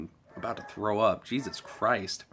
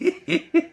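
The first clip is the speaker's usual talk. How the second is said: high giggle; 7 dB louder than usual. Real, not acted. Laughing at own impression.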